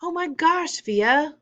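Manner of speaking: high, thrilled squeal; screaming with excitement. A narrator's reading. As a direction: cry out in overjoyed amazement. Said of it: "Mom was screaming with excitement".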